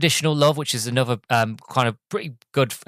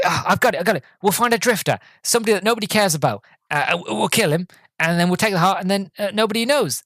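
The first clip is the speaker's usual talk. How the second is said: higher-pitched acted brainwave; scheming brainwave; 7 semitones higher than usual. Driver proposing his plan, higher mock-excited voice. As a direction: lightly imitate a man spilling out a plan.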